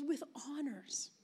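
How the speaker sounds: slow hushed aside; whispered. Retold or imitated. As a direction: whisper it like a proud secret. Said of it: "And then she goes".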